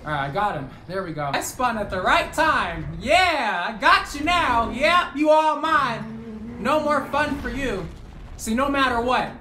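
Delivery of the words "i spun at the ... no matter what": louder, higher, slow gloating taunt; gloating gamer trash talk; hype taunt. Real, not acted. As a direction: savour the win over the enemy. Winning the fight.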